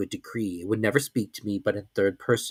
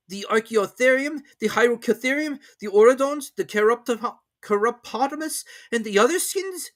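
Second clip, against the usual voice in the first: higher, steady servant listing specimens; servant's voice; 13 semitones higher than usual. A narrator's reading. Servant checking on the master's collection, higher even voice.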